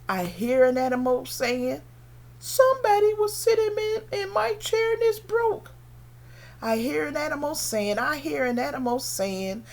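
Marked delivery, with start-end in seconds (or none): 2.4-5.7 high, squeaky little bear voice; baby bear's high-pitched complaint. Acted. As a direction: complain like a tiny storybook bear. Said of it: "The baby bear".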